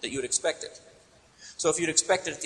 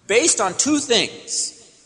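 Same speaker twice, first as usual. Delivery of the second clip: "Based on two things" drawn out very slowly, higher and louder; slow emphatic stress; 6 semitones higher, 5 dB louder, much slower than usual. Acted. Preacher gathering the passage into two points, slow and emphatic. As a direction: spell it out slowly for the class.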